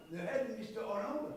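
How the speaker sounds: ordinary talk, nothing marked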